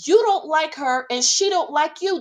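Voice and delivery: high, loud, exasperated ranting; worked-up rant. Real, not acted. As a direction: pile on the outrage. Heated rant about Mary.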